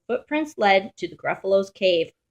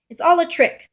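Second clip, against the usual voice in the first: high, loud cry; indignant outburst; 10 semitones higher, 6 dB louder than usual. Acted. Gruffalo's child decides the mouse is a trick, high and loud. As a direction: burst out, sure you've been fooled. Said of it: "said the Gruffalo's child".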